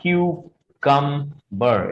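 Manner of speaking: cucumber slowly as "kyoo-kum-ber"; teacher modelling cucumber, slow and careful; correct pronunciation. Shown as a demonstration. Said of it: "The right pronunciation of this word is".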